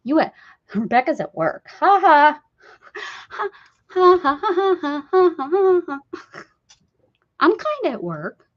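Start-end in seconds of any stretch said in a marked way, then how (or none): 1.8-6.0 high loud staged ha-ha; a hammed-up ha ha ha at a joke; mock laugh. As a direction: spell out a fake laugh, ha by ha.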